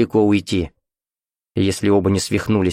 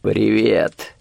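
slightly hoarse imagined greeting; lightly husky hello. A narrator's reading. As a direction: say it with a touch of rasp. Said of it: "и хрипло скажет".

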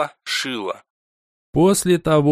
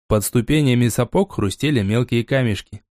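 plain reading voice

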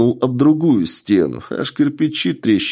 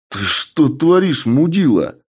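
angry; 6 semitones higher than usual. Acted. Hero shouts at attacking old man.

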